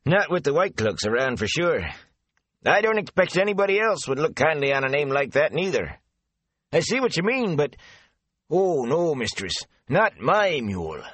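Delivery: a garrulous country man passing on rumours; chatty rustic gatekeeper. Acted. Avin jokes about mule.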